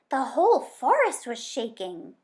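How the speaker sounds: the fox blurting it out; sly fox voice. A narrator's reading. Fox character voice.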